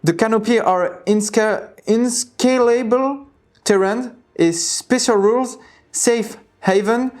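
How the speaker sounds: slow, stumbling English in a French accent; thick French accent. Real, not acted. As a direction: sound out hard English words with a French accent. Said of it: "j'ai vraiment un accent de merde".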